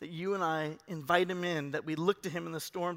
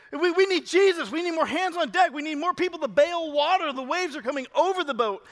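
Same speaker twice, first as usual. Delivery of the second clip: loud, high urgent calls for help; panicked disciples; 8 semitones higher, 6 dB louder than usual. Retold or imitated. Disciples calling for help in a swamped boat, urgent panic. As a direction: raise the alarm as the boat fills.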